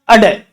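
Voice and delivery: "अडे" with a short vowel before a flapped ḍ; flapped ड़ sound. Shown as a demonstration. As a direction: say "अडे" as a-ṛe, tongue flicking down. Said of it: "इसका उच्चारण करने के लिए ... इसके आगे ए लगा दूंगा".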